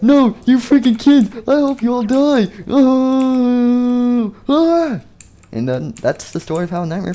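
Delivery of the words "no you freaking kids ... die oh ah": much higher, loud raging yell ending in a scream; furious falling scream. Acted. Nightmare Fredbear cursing the kids as he falls, screaming. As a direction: rage at them as you fall. Villain falls to his death.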